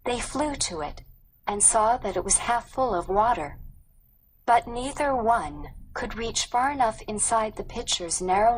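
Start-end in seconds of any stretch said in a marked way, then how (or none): none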